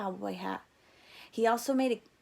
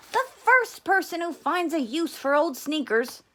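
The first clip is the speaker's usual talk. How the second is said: higher, louder, excited inventor father; father's voice; 7 semitones higher, 7 dB louder than usual. A narrator's reading. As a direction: sell the dream like a proud dad. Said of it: "he said".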